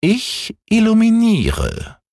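hoch und gedehnt gerufen; kleine Katze. Acted. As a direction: von oben verspielt herabrufen. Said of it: "rief die kleine Katze herunter". (hohe, langsame Katzenstimme)

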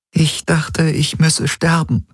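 high, fragile woman's voice; a painful confession, held together; close to tears. Acted. Woman confides in the man who holds her, close to tears. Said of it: "Ylanas Augen glitzerten feucht".

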